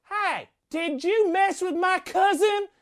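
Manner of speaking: put-on high, slow menacing kid voice; scary big kid. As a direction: confront him like an oversized playground bully. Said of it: "scary kid comes up to me".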